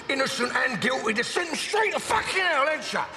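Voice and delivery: ordinary delivery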